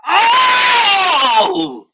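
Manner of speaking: high, long, loud "oh!"; loud cry. Real, not acted. Watching England's chance go begging, loud cry. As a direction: cry out at a near miss. Reacting to a live match chance.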